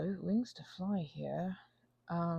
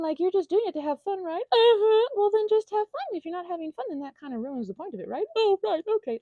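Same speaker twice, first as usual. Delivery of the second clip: much higher, louder, gushing reassurance; kind ASMR artist voice; 14 semitones higher, 9 dB louder than usual. Retold or imitated. Imagined nice creator reassuring little listener, high and gushing. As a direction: gush sweet comfort like a cheerful friend. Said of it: "they'd all be like".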